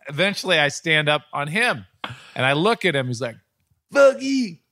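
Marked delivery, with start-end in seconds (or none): 3.9-4.6 very high, strained furious yell; angry heckler. Retold or imitated. Angry fan cursing the announcer, high strained yell. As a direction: scream abuse like an enraged fan.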